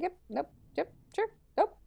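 high, quick brush-off in mock agreement; soft, singsong mock denial; dismissive deniers. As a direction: imitate people hurriedly waving it away. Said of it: "everyone being like".

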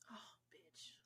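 breathy under-the-breath whisper. Real, not acted. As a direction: hiss it out to yourself. Sudden realisation, aside.

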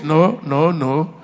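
usual speaking voice

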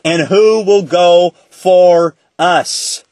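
slow, spaced-out scripture quotation; solemn quoted voice. Retold or imitated. Teacher quoting the voice Isaiah heard, slow and deliberate. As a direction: draw out each word, weighting the final "us". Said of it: "I heard a voice saying".

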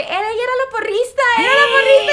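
high, fast mock crowd chant. Fans spot the rival cheerleader, mocking high chant. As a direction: imitate the crowd pointing her out. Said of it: "todo el mundo de que".

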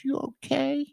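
put-on high small toddler voice; high childlike "you okay?"; little kid voice. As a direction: ask it like a tiny toddler. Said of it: "he goes".

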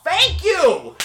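loud, high-pitched outburst of thanks; emphatic vindicated thanks. Acted. Host thanks doctor for backing him, loudly vindicated. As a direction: cry it out in triumph.

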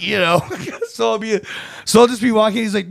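usual speaking voice

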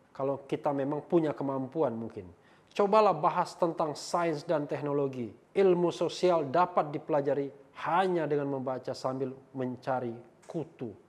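loud, declaimed read-out; forceful recital with punched key words; emphatic comment reading. Acted. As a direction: proclaim the comment phrase by phrase. Reads hostile comment aloud.

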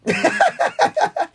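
high unvoiced laugh; wheezy burst of laughter. Real, not acted. Laughing at a slip.

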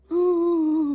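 a short high startled gasp; breathy startled huh; imitated gasp. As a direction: mimic a sob-like gasp from the stall. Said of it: "macam gitu tau".

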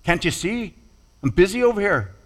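hurried complaint to her sister; annoyed Martha. Acted. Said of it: "looks at Mary and says, like".

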